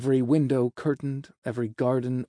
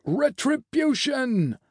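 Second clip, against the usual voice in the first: long, high drawn-out cry; slow, soaring shout of accusation; 12 semitones higher, slower than usual. A narrator's reading. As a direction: stretch it out like a distant mob's chant. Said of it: "overhead the shouting was continuing".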